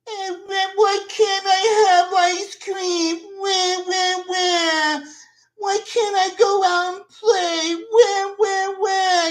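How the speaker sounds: put-on high, slow, whining spoiled child; mock a pampered kid begging for ice cream; whiny little kid. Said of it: "he's whining".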